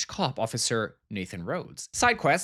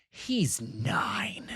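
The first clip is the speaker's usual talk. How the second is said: whisper; 3 dB quieter than usual. Real, not acted. Shocked aside.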